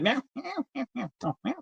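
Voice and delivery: high, soft little meows; playful cat meows. Pretend. Greeting Sleeping Comic on stream with soft kitten meows. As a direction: mew like a tiny kitten.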